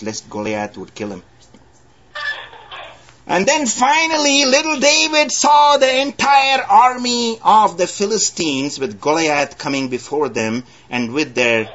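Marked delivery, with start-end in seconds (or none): 3.3-8.8 high, ringing preacher's voice; fervent pulpit delivery; preaching. Acted. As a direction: lift it up to the congregation. Preaching the army approaching.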